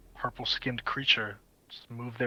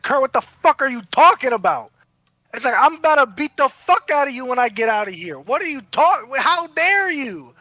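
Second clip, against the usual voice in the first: loud, high outraged threats; Cadmus furious; 10 semitones higher, 10 dB louder than usual. Retold or imitated. Cadmus yelling at Kurt over the insult, furious shout. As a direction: blow up at Kurt, ready to fight. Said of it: "He's like".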